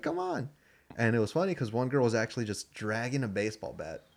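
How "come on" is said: high-pitched, imitated coaxing; mock pleading from an exasperated dad. Dad to sons afraid to talk to girls, coaxing.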